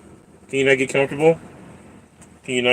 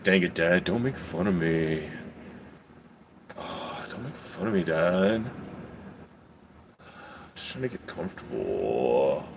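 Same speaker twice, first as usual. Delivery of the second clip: cat's voice; 6 semitones lower, 12 dB quieter than usual. Pretend. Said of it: "He's like".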